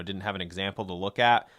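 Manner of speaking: ordinary talk, nothing marked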